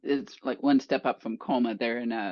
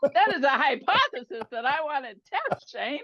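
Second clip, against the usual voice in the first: laughing; 9 semitones higher than usual. Real, not acted. Joking about million-dollar pay.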